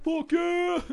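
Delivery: very high, loud whooping shout; imitated crowd cheer. Fan imitating everyone as pyro goes off, whooping cheer. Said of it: "everyone's like".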